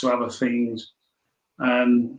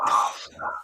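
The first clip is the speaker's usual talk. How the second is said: breathy under-the-breath whisper; a private groan of dread, whispered; whispered thought; 8 dB quieter than usual. Retold or imitated. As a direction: say it to yourself, almost voiceless. Silent dismay at football question.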